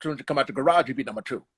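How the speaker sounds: fast-talking hype man impression; Bundini Brown. Comedian recalling his film role, impassioned cornerman impression. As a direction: run it together in a put-on character voice. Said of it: "uh, Bundini Brown".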